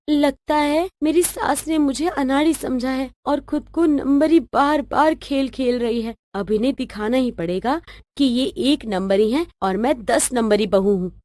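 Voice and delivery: quiet, calculating inner monologue; scheming aside. Acted. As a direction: plot to yourself under your breath. Scheming daughter-in-law's private aside.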